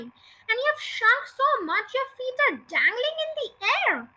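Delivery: high-pitched exclamation; crying out. A narrator's reading. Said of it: "he cried".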